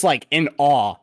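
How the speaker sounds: ordinary delivery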